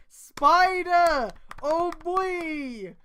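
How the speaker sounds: high, loud startled cry; mock alarm. Reader startled by the fic's spider, mock alarm. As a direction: yelp like you saw a spider.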